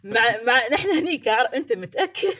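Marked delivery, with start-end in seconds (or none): 1.5-2.3 higher, laughing you-sure; laughing disbelief. Acted. Host teasing co-host who doubts YouTube numbers, laughing disbelief. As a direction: tease him with a laugh of doubt.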